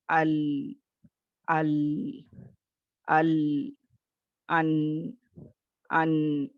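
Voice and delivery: "अल" and "अन" with a sound that carries on briefly; medium letter demo. Shown as a demonstration. Teacher demonstrating correct medium articulation. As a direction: keep the voice running, moderately. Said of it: "दरमियानी आवाज़ को ले जाते हुए अदा करेंगे".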